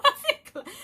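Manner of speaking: high, laughing as mom on the phone; laughing mom quote. Retold or imitated. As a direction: imitate mom calling, cracking up. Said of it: "she like".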